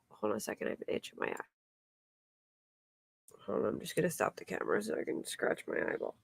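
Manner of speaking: softly muttered aside, half whispered. Real, not acted. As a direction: whisper it quickly, as if off camera. Stops to scratch her eye.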